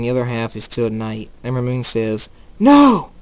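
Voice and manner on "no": loud, high-pitched cry of protest; shouted refusal. Acted. Ember Moon as the sky turns back to day, shouting. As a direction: shout it out in defiance.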